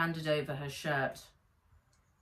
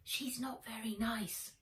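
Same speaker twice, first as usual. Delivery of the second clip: hushed, much softer aside; higher, soft confiding boy voice; whispered; 5 semitones higher, 8 dB quieter than usual. A narrator's reading. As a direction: say it behind her back, softly. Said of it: "whispered Henry".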